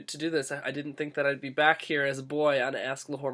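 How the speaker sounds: ordinary delivery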